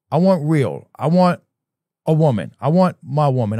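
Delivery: normal talking voice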